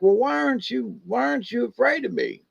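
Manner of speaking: mock gruff bear. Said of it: "trying to be gruff right back to her".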